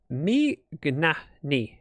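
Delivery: slow, careful [name]; childish [name]. Shown as a demonstration. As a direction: say [name] as little kids do. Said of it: "my name is forever".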